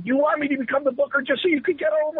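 fast, high-pitched indignant outburst; retold yelling back. Chief yells back at the ambitious wrestler, indignant. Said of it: "he yelled back at me".

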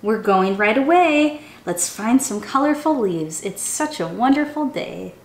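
lively, sing-song storybook reading; animated children's story voice. A narrator's reading. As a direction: read it like a chant for little kids. Reads a picture book aloud.

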